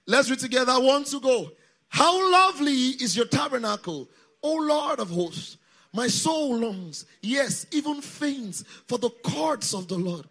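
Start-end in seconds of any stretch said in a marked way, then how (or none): 1.9-10.2 loud, slow declamation; congregational scripture reading. Real, not acted. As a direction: lead the crowd through the psalm. Leads congregation reading the psalm aloud.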